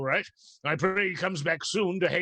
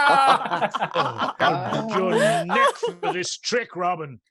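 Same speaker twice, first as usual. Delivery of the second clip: much higher, slow, laughing through the mock threat; 5 semitones higher, 5 dB louder, slower than usual. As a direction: pretend anger dissolving into laughter. Friar tricked by the king.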